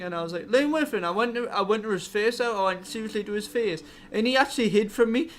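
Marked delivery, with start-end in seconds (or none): 0.5-1.0 shocked disbelief. Retold or imitated. Hears name of accuser.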